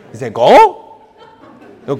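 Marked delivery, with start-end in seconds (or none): 0.3-1.0 loud, one-word imitated order; mock blunt command. Indian brother telling the traveller to go ahead, mock loud command. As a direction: fire it out as a loud, simple instruction.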